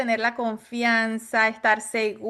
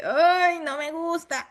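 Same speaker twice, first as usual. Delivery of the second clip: mock disgusted buyer; 5 semitones higher, slower than usual. Imitating first-time buyer.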